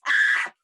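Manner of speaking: loud mock scream; sudden hammed-up shriek; imitated scream. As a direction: scream like the spooked friend. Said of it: "No, don't scream".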